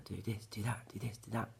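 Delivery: whispered orders into her ear; soft unvoiced hiss of commands; hushed whisper. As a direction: whisper the instructions like poison. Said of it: "Telling her".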